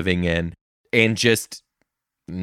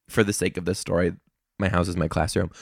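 ordinary talk, nothing marked

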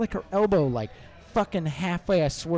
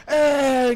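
loud, high mock whine of disgust; mock exasperated groan; 10 semitones higher, 11 dB louder than usual. Offended girl reacting to being brushed off, mock exasperation. Retold girl's exasperated noise when insulted.

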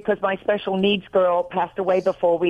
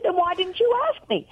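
high-pitched retold reproach; mock exasperated; 9 semitones higher than usual. Wife retelling her comeback to husband, exasperated. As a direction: act out an incredulous wife's comeback.

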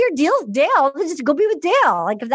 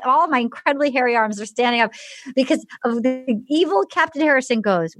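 ordinary talk

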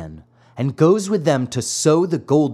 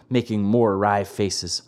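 ordinary delivery, nothing marked

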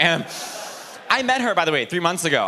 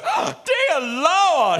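much higher, slow fussy gasp; C-3PO; 9 semitones higher, much slower than usual. Retold or imitated. C-3PO exclaiming in Return of the Jedi, high slow fussy. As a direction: clutch your pearls like a prissy robot. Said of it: "he literally goes".

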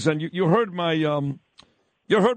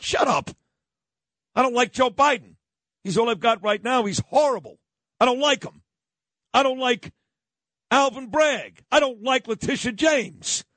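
heated rant; 7 semitones higher than usual. Real, not acted. Heated rant listing disliked figures.

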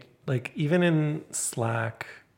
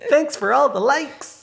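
much higher, slow, sugary influencer voice; cutesy TikTok voice; 14 semitones higher, 9 dB louder, slower than usual. Retold or imitated. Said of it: "sort of cutesy TikTok style".

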